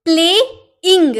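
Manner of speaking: "प्लेइंग" drawn out slowly in two beats, प्ले... इंग; split into syllables. Shown as a demonstration. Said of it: "दो बार हम लोग बोले ना".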